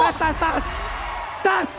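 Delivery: high, loud repeated calling of the name; urgent calling. Real, not acted. Caster calls hero in danger.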